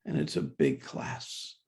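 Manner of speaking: ordinary talk, nothing marked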